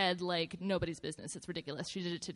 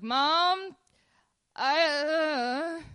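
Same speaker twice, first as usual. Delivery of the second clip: high, loud mock wail for mom; frightened calling; 7 semitones higher, 6 dB louder, much slower than usual. Child calling mom after seeing the ghost, acted alarm. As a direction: call out high and loud, then falter into a whine.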